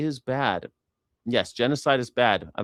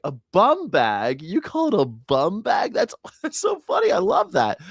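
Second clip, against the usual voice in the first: much higher, gushing delight with a laugh; gushing delighted American; 7 semitones higher than usual. Retold or imitated. Delighted American hearing British slang, gushing high voice. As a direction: squeal with delight at "bum bag". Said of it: "They'd be like".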